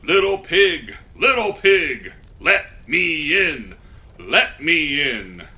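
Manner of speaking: loud, slow wolf calling at the door; big bad wolf. A narrator's reading. Wolf calling loudly to the little pig. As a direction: boom it out like a hungry wolf at the door. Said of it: "he knocked on the door and he said".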